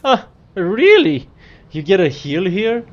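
high, loud disbelieving huh really; surprised. Real, not acted. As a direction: genuinely caught off guard. Reacts to soup heal.

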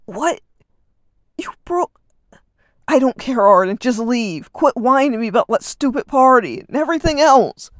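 loud, furious growl. Acted. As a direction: rage at his little brother. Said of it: "Reed growled".

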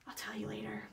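breathy secret whisper. Acted. Narrator whispering to friend Eli about the secret. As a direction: whisper it so nobody else hears.

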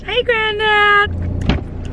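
high, slow drawn-out hello; greeting granddad in a long sing-song call. Real, not acted. Vlogger calling out a sing-song hello to granddad.

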